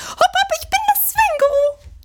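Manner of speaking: quietschig hoch und hüpfend; hohe Figurenstimme. Acted. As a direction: piepsig fröhlich vorstellen. Swinguru stellt sich vor. (hohe Kuscheltierstimme)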